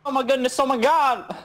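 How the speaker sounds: high, loud, frantic yelling; hyped gamer bro. Real, not acted. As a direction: freak out over the play. Freaking out during a play.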